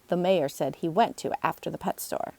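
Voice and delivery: ordinary delivery, nothing marked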